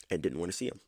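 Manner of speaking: ordinary delivery, nothing marked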